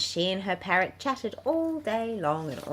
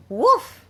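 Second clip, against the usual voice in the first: a loud, high yappy woof; playful mock bark; bird barks woof; 12 semitones higher, 10 dB louder than usual. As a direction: bark it like a silly little dog. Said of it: "said the bird".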